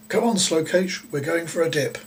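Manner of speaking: high, loud eager call; cheerful call. A narrator's reading. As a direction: chivvy her along playfully. Friend calling Dee to swim.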